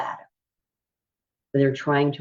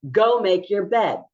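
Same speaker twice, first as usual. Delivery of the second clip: harsh command; 6 semitones higher, 4 dB louder, slower than usual. Shown as a demonstration. Teacher showing an escalating tone, high and loud. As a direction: go make your bed barked high and loud. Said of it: "which one would cause you to escalate".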